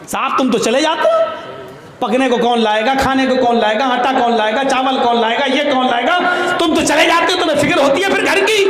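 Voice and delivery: high, rising nagging wife's rant; scolding wife. Retold or imitated. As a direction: nag him like an angry wife, voice climbing high. Wife scolding Umar in story. (ڈانٹتی ہوئی بیوی کی اونچی آواز)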